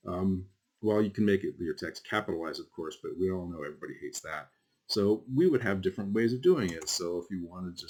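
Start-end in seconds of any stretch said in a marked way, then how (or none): none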